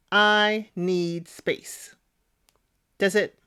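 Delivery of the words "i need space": each word of I need space laid down slowly; slow and deliberate. Shown as a demonstration. Commentator stressing how little Alex had to say, very slowly. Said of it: "Three words".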